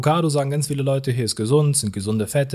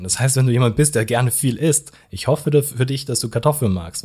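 ordinary talk, nothing marked

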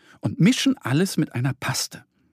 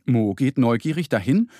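ordinary delivery, nothing marked

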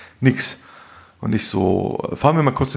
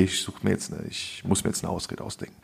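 hushed aside to himself; whispered thought; 3 semitones lower, 11 dB quieter than usual. Retold or imitated. Recalling his excuse plan to the co-host, whispered. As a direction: barely voice it, like a secret plan. Inner thought on a bad date.

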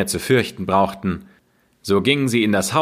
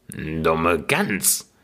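scornful witch; 4 semitones lower, 4 dB quieter than usual. Acted. Witch scolding Gretel as a stupid goose, low and scornful. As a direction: lower and softer, a contemptuous witch's grumble. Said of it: "sagte die Alte".

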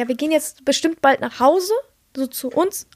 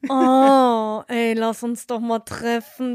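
mimicking the guy's invitation; 4 semitones lower than usual. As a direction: play the clingy guy asking her out, slowly. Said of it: "Am Schluss kam der noch mit".

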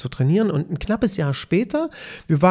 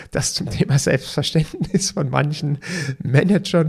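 usual speaking voice

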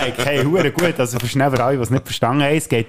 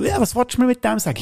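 higher, faster mock outrage; lightly voiced huffy American tourist; mock indignant tourist; 13 semitones higher, faster than usual. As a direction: squeak out the offended question. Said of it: "und er so".

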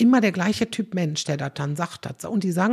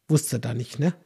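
ordinary delivery

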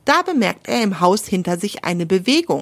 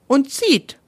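effortful pull, voice pitched up; straining harder, higher; 6 semitones higher than usual. A narrator's reading. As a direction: push out the words with strain. Eichhörnchen zieht mit aller Kraft. (angestrengt, höher gezogen)

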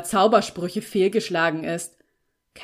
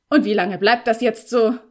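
loud, irate question; angry demand; 2 semitones higher, 7 dB louder than usual. A narrator's reading. Felix pacing angrily before the girl, demanding. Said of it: "Wütend lief er".

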